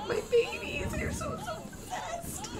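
tiny, very high, soft whimper; tearful lament. Real, not acted. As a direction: squeak it out slowly as if about to cry. Laments her possessed Sims.

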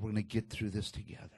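low, very soft and quick; quiet comfort from a loving wife; gently reassuring. Retold or imitated. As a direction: drop the voice low to reassure tenderly. Wife reassures her burned husband.

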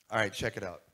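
ordinary talk

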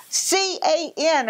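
high, slow, letter by letter; spelling it out slowly. Retold or imitated. To the phone operator, spelling slowly and high.

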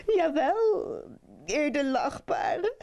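high, mock-tearful reply; narrator's acted sobbing thief. A narrator's reading. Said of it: "zei de dief snikkend".

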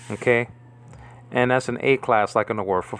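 ordinary delivery

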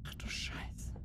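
whispered curse; hushed swear, barely voiced. Real, not acted. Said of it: "Muss jetzt leise sein".

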